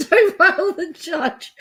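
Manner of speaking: high-pitched words through long laughter; real uncontrollable laughing. Real, not acted. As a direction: laugh so hard you can barely talk. Cracking up at the courtroom clip.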